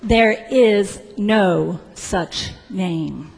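very slow, word-by-word refusal; measured, final disapproval; disapproving official. Acted. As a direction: refuse it slowly, spacing out each word. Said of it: "And finally she said".

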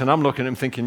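ordinary talk, nothing marked

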